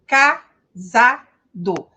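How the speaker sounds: Casado slow, every syllable spelled out. Shown as a demonstration. Teacher shows students Portuguese syllabic reading, slowly. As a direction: stretch Casado into three even syllables. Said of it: "sílaba a sílaba".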